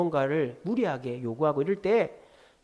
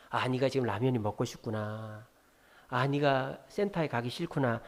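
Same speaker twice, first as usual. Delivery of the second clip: low, soft empathic acknowledgement; polite refusal; 3 semitones lower, 5 dB quieter than usual. Shown as a demonstration. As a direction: gently turn the child down through understanding. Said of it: "이렇게 정중하게 거절하면".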